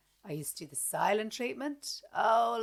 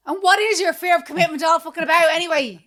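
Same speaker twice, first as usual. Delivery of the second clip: angry outburst; 8 semitones higher, 16 dB louder than usual. Retold or imitated. Escalating row with her fella.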